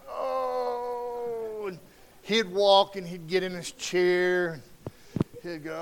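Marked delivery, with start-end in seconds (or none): 0.1-1.7 put-on old man groan. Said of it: "'cause he'd get up going".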